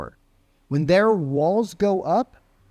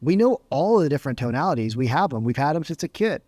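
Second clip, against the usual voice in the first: ordinary talk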